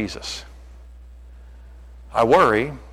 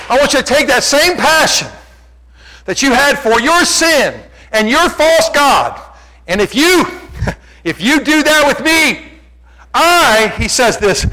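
preach at full force; 12 semitones higher, 9 dB louder than usual. Acted. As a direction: belt it out like a fired-up preacher.

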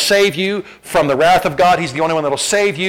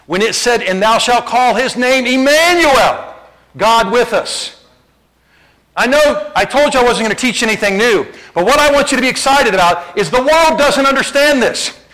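preaching at full force; 7 semitones higher, 4 dB louder than usual. Acted. Preacher urging the church to be excited Jesus is God, fervent preaching. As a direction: build the fervour word by word.

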